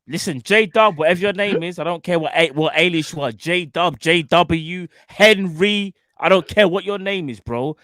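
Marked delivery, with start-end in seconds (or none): none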